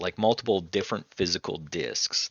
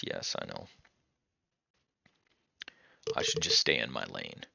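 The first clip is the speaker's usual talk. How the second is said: ordinary talk, nothing marked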